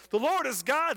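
high, loud, elated outburst; excited crowd cry. Acted. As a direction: shout it like a thrilled crowd. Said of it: "They're all excited".

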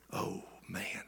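very soft, low breath of wonder; hushed awe. Acted. Reacting to Jesus's statement, hushed awe. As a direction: barely voice it, stunned.

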